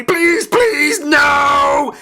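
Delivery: high, loud, frantic begging; panicked wail for mercy; shrieked pleading. A narrator's reading. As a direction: beg at a shriek. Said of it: "shrieked Henry".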